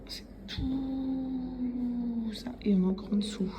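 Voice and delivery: ordinary delivery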